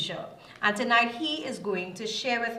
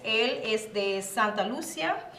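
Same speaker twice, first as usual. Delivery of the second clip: usual speaking voice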